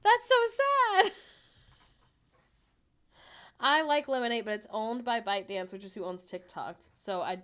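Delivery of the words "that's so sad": high, loud through laughter; laughing. Real, not acted. Streamer reacts to losing a follower, laughing hard. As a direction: squeal it out while laughing.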